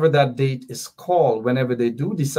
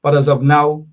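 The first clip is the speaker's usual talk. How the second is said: ordinary talk, nothing marked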